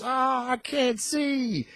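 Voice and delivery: high, slow whiny cry of a blinded man; mock panicked whimper; blinded bystander. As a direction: wail it like you suddenly can't see. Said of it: "somebody goes".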